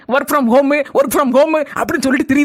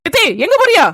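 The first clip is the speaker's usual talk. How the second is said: raised call down the lane; calling after; 6 semitones higher than usual. Acted. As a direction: shout after her as she leaves. Calling after departing mother-in-law.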